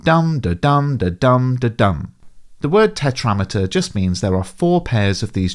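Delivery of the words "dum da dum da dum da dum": dum da dum da, slow strong-weak chant; trochaic beat. Shown as a demonstration. Teacher chanting the trochaic beat as dum da. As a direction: chant the trochee rhythm slowly. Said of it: "it sounds like".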